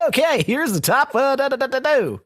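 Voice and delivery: high-pitched mock game show announcer; game show host. Shown as a demonstration. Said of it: "like, game show".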